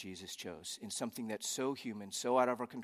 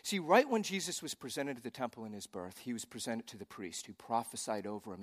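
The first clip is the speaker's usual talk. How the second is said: ordinary delivery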